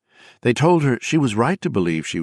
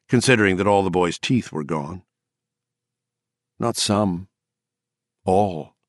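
ordinary delivery, nothing marked

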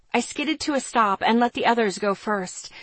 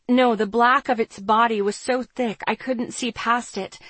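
ordinary delivery

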